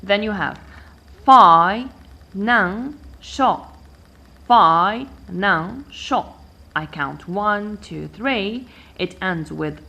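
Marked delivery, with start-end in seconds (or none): 1.2-3.7 fi, nang, sho as three separate beats; divided into syllables. Shown as a demonstration. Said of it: "I divide the word first". 4.5-6.3 Fi, nang, sho with a gap after each; divided into syllables. Shown as a demonstration. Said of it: "I divide the word first".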